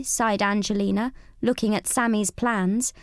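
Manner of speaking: usual speaking voice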